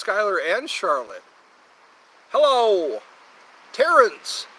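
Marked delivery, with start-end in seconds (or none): 2.3-4.5 loud greeting. Real, not acted. Greeting a chatter named Terrence.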